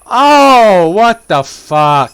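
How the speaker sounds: high loud shocked yell; scared horror game streamer. Real, not acted. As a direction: recoil from a jump scare. Stroller moved on its own.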